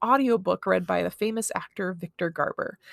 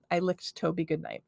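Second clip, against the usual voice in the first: plain reading voice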